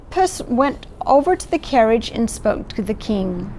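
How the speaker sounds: ordinary delivery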